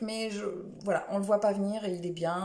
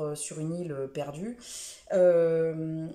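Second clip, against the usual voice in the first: ordinary talk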